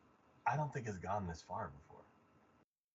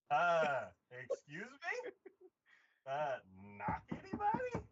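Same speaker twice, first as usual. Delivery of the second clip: high, slow, affronted questions; hammed-up incredulous objection; mock indignation; 4 semitones higher, slower than usual. Viewer objecting to sister barging in, mock indignation.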